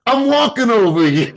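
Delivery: a hammed-up East Coast accent, mocking in fun. Retelling how Vernon copied the friend, laughing East Coast accent. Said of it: "in an East Coast accent".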